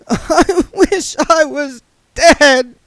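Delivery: put-on a young woman breaking down in hysterical tears; sobbing. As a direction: sob it through laughter. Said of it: "she sobbed".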